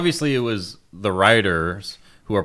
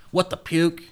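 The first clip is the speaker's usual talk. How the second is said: mock Canadian slang; 9 semitones higher than usual. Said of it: "a normal thing they say".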